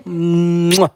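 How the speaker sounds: a loud smacking air kiss; blown kiss. Real, not acted. To his viewers at the end of the stream: loud blown kiss. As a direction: blow them a big noisy kiss.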